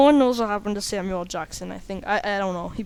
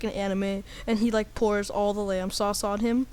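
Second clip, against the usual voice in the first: ordinary delivery, nothing marked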